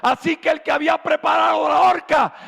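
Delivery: loud, high preacher's proclamation, slowing; preaching at full force. Acted. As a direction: proclaim it to the congregation.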